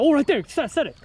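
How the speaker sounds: high, sudden cry at the strike; excited outburst. Real, not acted. Angler to buddy as a fish hits, excited outburst. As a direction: urgently call the hookset, pitch leaping.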